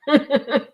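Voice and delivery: high, short rueful laugh. Real, not acted. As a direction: let out a quick rueful laugh. Laugh after confusion.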